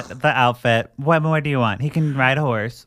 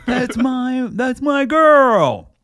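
higher, louder, drawn-out proud cry; proud amazed boyfriend; 13 semitones higher, 6 dB louder, much slower than usual. Retold or imitated. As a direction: swell with pride as he sees his girl on TV. Colin sees her on TV.

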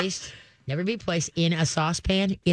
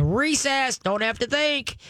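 higher, playful voice of a dog let loose; mock excited dog; 8 semitones higher, slower than usual. Trainer voicing the dog's reaction to good boy, light mock glee. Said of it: "It's like saying".

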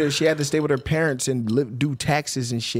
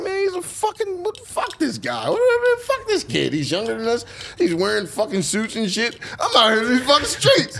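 retold rant; 10 semitones higher than usual. Complaining about the kid his grandma compared him to, a high mock rant. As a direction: vent like a jealous kid. Resenting the boy grandma praised.

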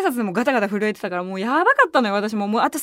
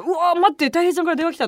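excited; 6 semitones higher, faster than usual. Retold or imitated. Idol calling her phone.

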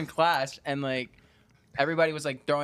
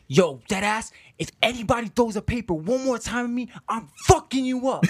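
higher-pitched pretend outburst; mock threat; 5 semitones higher than usual. Class clown warning classmates over thrown paper, mock threat. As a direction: threaten the whole room, half in jest. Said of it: "I'm just, like, kidding".

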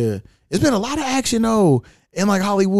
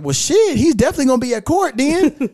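higher-pitched, animated retold reaction; lively quoted outburst, pitched up; retold exclamation; 8 semitones higher than usual. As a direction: exclaim it as you retell your own reaction. Retelling own astonished reaction in quote.

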